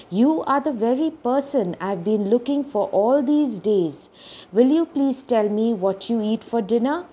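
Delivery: plain reading voice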